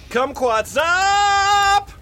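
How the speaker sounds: slow, high, stretched-out cry; drawn-out high call. Acted. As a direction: stretch it like a silly announcer call. Shouted catchphrase drop.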